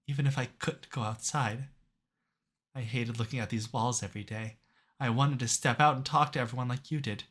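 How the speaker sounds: fading, tender confession; weak dying voice. Acted. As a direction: barely hold on as you speak.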